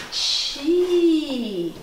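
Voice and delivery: CHIPSY stretched out slowly sound by sound; drawn-out dictation. Shown as a demonstration. Teacher dictating Chipsy to a pupil, drawn-out dictation.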